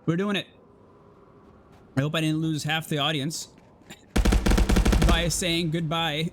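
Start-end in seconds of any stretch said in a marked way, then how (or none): 4.1-5.0 real loud laugh. Real, not acted. Laughing at own goodbye.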